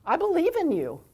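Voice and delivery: high, eager belief; empowering. Shown as a demonstration. Coach showing empowerment to a player, high and eager. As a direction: lift the kid up with conviction. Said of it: "that's empowerment".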